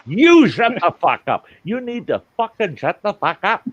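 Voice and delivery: high, loud escalated argument yell. Retold or imitated. Storyteller replays hour-long spat with partner, yelling. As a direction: yell it back as a mock row boiling over. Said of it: "we're yelling at each other".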